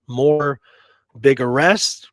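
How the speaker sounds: normal talking voice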